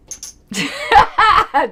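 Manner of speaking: high, loud burst of laughter; real laugh. Real, not acted. Critic amused by her own argument, laughing. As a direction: crack up mid-argument.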